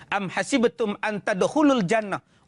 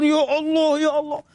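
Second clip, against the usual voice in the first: high mock wail to God; copycat weeping prayer; 7 semitones higher, 3 dB louder than usual. Congregants copy weeping Arabs at kunut, high mock tearful wail.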